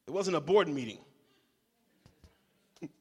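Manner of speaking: ordinary talk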